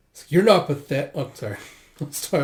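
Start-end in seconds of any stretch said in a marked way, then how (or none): 0.3-1.1 loud, high acted yell, cut short; mistaken shout. Reader voicing the line as if screaming, loud. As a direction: shout the reassurance by mistake, then break off. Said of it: "I was reading the other line of screaming".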